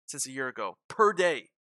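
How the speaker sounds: usual speaking voice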